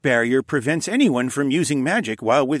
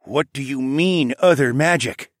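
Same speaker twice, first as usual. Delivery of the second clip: pressing; 6 semitones higher, 6 dB louder than usual. A narrator's reading. King questions prince about magic, pressing. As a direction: press hard for an explanation. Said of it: "Tecumseh pressed".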